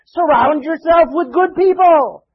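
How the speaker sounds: loud, high, slow preaching; emphatic exhortation. Real, not acted. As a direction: drive it home to the congregation, word by word.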